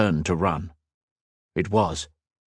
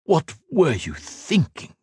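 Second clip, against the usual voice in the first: slow, pitched-up scoff; 9 semitones higher, slower than usual. A narrator's reading. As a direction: sneer at the fool. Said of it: "scoffed the Emperor".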